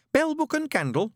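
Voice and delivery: put-on high, meek young recruit; nervous Newt. A narrator's reading. Newt answering his sergeant, high and meek.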